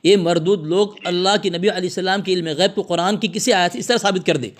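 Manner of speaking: usual speaking voice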